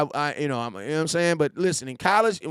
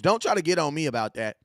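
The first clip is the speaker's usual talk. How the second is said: ordinary talk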